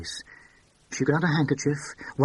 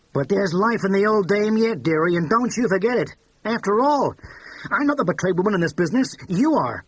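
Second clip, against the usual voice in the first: put-on loud, higher brassy older woman, tipsy and defiant; brassy Tilly; 8 semitones higher, 5 dB louder than usual. A narrator's reading. Tilly taunting Miss Fleur over drinks, brassy and loud. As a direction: belt it out like a bold old dame at the bar.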